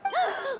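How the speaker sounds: a quick startled gasp; sudden realizing gasp; sharp gasp. Acted. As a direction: gasp as it dawns on you. Realizes Lyney may be targeted.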